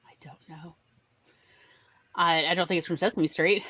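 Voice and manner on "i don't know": soft whispered aside. Real, not acted. Host admits she doesn't know, whispered. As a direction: whisper it under your breath.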